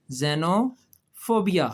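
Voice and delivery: xenophobia very slow, opening with z; slow pronunciation. Shown as a demonstration. Teacher sounding out a hard word for children, very slowly. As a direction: draw out xenophobia syllable by syllable, ze-no-pho-bia. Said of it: "क्या लफ्ज़ है ये".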